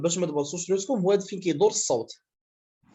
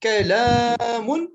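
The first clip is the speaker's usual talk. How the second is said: كلام with the long aa stretched out on one steady note; drawn-out syllable; 4 semitones higher, 5 dB louder, slower than usual. Shown as a demonstration. Teacher showing the sound does not turn, كلام drawn out. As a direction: hold the long vowel of كلام slowly, unbroken. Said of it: "parce que le son n'a pas tourné".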